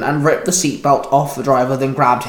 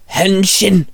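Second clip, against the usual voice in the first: transformation call; 5 semitones higher than usual. A narrator's reading. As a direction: announce the transformation with force. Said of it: "Shinzo called out".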